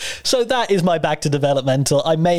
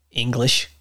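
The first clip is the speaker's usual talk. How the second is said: imitating an Aussie parking attendant, lower; Australian accent; 6 semitones lower than usual. Mimicking Australian car park staff to co-host, lower Aussie accent. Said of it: "Good accent, by the way".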